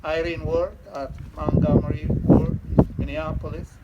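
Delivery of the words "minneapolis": ordinary talk, nothing marked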